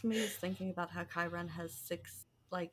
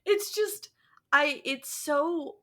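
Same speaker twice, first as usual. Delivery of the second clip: exasperated breakdown; 5 semitones higher, 4 dB louder, slower than usual. Real, not acted. Overwhelmed by the wrong adaptation.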